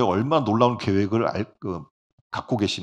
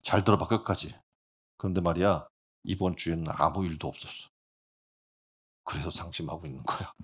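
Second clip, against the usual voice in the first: glum punchline; 5 semitones lower, 4 dB quieter than usual. Acted.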